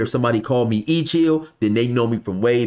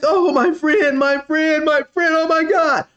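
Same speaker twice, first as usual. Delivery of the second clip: high, wailing imitation of a crying man; mock crying dude; 3 dB louder than usual. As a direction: ham up his tearful wail, pitched way up. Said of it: "The dude is crying".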